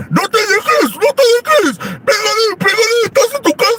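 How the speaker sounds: very high squealing egging-on; hammed-up shrill mother-in-law urging a fight. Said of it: "Así le decía". (suegra chillona incitando a pelear)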